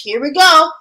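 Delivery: normal talking voice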